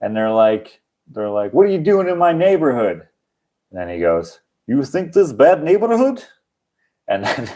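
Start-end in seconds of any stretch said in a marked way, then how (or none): 1.5-3.1 higher-pitched imitation of a street thug; mock thug. Thugs confronting a comedian outside, mock challenge. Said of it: "there's these thugs out there". 4.7-6.2 high-pitched put-on Russian accent; mock Russian accent. Comedian answering thugs in his neighborhood, mock Russian accent. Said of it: "Russian accent saves my life".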